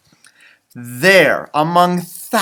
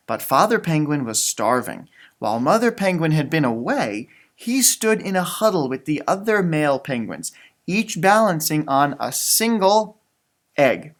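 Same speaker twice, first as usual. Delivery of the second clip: gentle read-aloud storytelling voice; story narration; 3 semitones lower than usual. Acted. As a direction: read it like a bedtime picture book. Reading picture book aloud.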